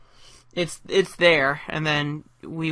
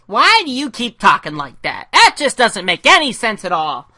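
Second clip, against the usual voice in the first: much higher and louder, a squeaky excitable little guy; high-pitched Gizmo; 7 semitones higher, 9 dB louder than usual. Acted. Creator voicing Gizmo for his animatic, high-pitched and loud. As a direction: chirp it like a hyper cartoon sidekick. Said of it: "kind of the high-pitched guy".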